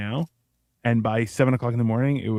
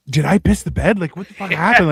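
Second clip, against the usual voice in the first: higher, louder panicked quote; freaked-out quote; 4 semitones higher, 6 dB louder than usual. Retold or imitated. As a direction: act shocked and grossed out. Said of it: "you're like".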